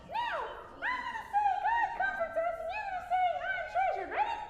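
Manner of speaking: very high, tiny squeak of a voice; squeakiest voice. Pretend. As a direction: squeak it out like a cartoon mouse. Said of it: "use your squeakiest voice".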